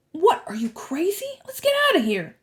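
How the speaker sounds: high, incredulous voice; alarmed protest. A narrator's reading. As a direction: refuse and urge escape.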